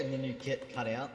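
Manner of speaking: ordinary talk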